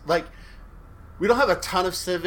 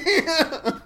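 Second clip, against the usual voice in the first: high giggle; 3 dB louder than usual. Real, not acted. Laugh after quote.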